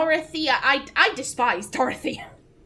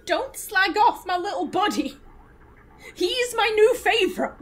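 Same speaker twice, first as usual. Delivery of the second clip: playful mock protest; 6 semitones higher, 3 dB louder than usual. Real, not acted. Defending the drone playfully.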